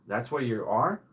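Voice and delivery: usual speaking voice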